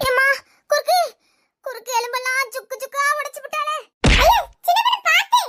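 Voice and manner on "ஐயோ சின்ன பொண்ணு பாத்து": high, loud warning cry; alarmed shout. Acted. Friend shouts a warning to the girl in the fight, high and loud. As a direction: shriek a warning across the fight.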